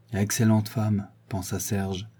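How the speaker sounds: plain reading voice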